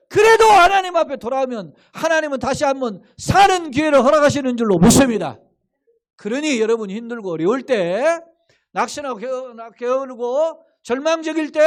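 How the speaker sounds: preaching at full force. Acted. Preacher urging the congregation to rise again, at full force. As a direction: proclaim it like a revival preacher.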